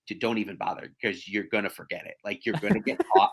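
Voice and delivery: low, soft deadpan warning; husband's understated don't-bother tone; dismissive husband. Retold or imitated. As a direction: drop down low and discourage them quietly. Voices husband's dismissive advice.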